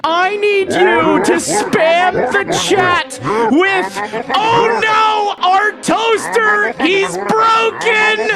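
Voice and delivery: slow, high, drawn-out shout; hammed-up proclamation. Streamer teaching chat what to spam, hammed-up slow wail. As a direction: proclaim each word like a dire announcement.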